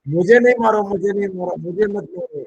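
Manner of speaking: high, loud playful begging not to be hit; hammed-up plea, voice high and loud at first, then fading; mock pleading. Host jokingly begs the speaker not to fire him, mock pleading.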